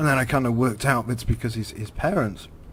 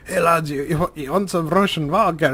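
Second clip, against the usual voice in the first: higher, hearty mock Russian accent; jovial Ukrainian father; 5 semitones higher, 4 dB louder than usual. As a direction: offer drinks in a put-on Russian accent. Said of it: "quite a jovial chap".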